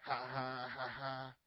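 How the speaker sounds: imitated jeering "ha, ha" laugh; mock laughter. Preacher mimics gunmen laughing at the pastor, mock laughter. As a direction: fake a low, deliberate laugh. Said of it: "And they start laughing".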